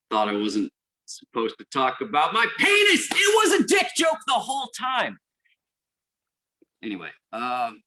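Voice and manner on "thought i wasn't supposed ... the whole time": building into a high mock-anguished shout; tearful, cracking voice. Patient answering family by the hospital bed, hammed-up tearful outburst. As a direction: start upset, then erupt into a hammed-up, high-pitched yell. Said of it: "through tears in your eyes and a crack in your voice".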